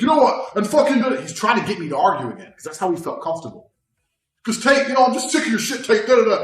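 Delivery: hammed-up angry opponent; mock provoking yell. Opponent provoking argument, imitated.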